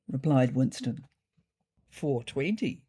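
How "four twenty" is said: ordinary delivery, nothing marked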